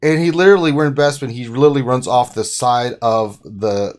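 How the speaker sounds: normal talking voice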